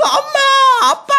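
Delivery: very high, crying-out shriek; mock wailing child. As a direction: shriek for mom and dad in mimicry. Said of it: "근데 막 뒤에서 막 울어대고". (엄마 아빠 찾아 울부짖는 흉내)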